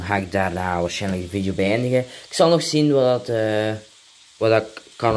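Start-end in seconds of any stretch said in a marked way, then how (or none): none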